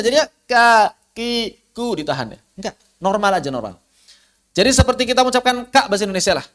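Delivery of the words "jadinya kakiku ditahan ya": kakiku with the k cut off and no air; held k, no breath. Shown as a demonstration. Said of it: "jangan ada hamsnya". (kaf ditahan tanpa hams)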